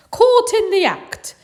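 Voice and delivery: high, loud, slow gotcha; dad catching mum red-handed; triumphant dad. Acted.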